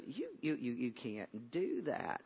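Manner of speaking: doubt him quietly, as retold Saul; faint, halting acted objection; hushed disbelief. Saul's soft disbelieving reply.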